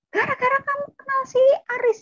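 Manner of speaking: high-pitched accusing outburst, imitating older sibling; angry blaming. Older sibling blames younger one's friend, high and angry.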